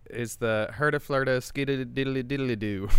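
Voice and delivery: a hint of a Southern babble; Southern caricature. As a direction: roll out the nonsense in a mock Southern cadence. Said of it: "this is a caricature".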